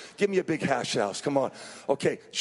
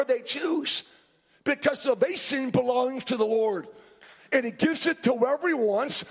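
energetic, raised sermon voice; driving pulpit storytelling; animated preaching; 10 semitones higher, 3 dB louder than usual. Real, not acted. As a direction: retell the Bible scene with mounting urgency.

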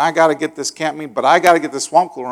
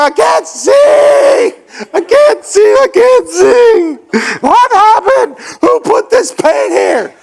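high, loud, half-laughing panic; panicked yelling; 7 dB louder, slower than usual. Retold or imitated. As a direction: cry out blinded and flailing. Blinded by paint, panicking.

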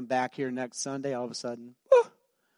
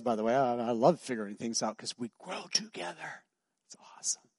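a private whispered remark; whispered aside. Real, not acted. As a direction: whisper it to them confidingly.

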